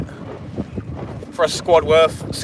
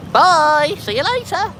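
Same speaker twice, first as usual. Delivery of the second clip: squeaky high goodbye; mock farewell; 10 semitones higher, slower than usual. Joking to viewers about falling overboard, high squeaky farewell. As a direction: chirp it up high like waving off.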